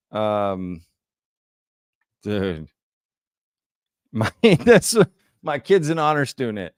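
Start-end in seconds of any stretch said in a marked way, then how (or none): 4.1-6.7 a high, loud squeal of laughter, then the line giggled out; laughing while reading. Real, not acted. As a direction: fight through the giggles to read it.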